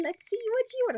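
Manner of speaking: high, cackly witch voice; a witchy, sly singsong. Real, not acted. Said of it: "It sounds like a witch".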